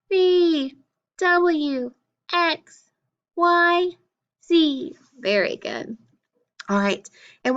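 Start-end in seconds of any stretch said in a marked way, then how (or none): 0.1-5.0 high-pitched letter names. Shown as a demonstration. Teacher reciting consonants for pupils, high voice. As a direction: chant each consonant high, sliding down. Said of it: "in a high voice".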